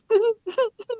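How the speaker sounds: high squeaky giggle imitation; high stifled giggle. Newhart giggling during the tribute, high giggle. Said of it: "But you hear this".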